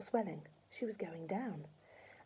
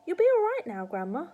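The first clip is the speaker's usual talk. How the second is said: cheery reassurance; 11 semitones higher, 10 dB louder than usual. A narrator's reading. Boy reassures grandma loudly.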